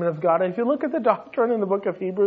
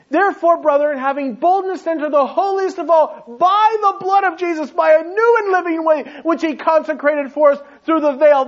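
higher, louder scripture recital; quoting scripture; 7 semitones higher, 5 dB louder than usual. Acted. Preacher quoting Hebrews to the congregation, fervent. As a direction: read the verse out with fervour.

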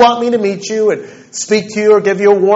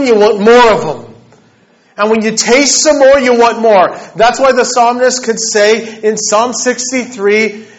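higher, louder, stretched-out passionate preaching; impassioned preaching; 5 semitones higher, 4 dB louder than usual. Acted. Preacher urging his congregation to seek God, fervent rising preaching. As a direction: pour it out to the congregation with rising fervor. Preaching builds to full force.

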